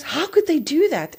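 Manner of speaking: high, loud mock outrage; retold husband's aggrieved cry; indignant outburst. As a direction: act out a hurt, upset protest. Retells husband's upset phone reaction.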